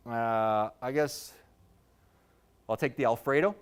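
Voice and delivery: ordinary talk, nothing marked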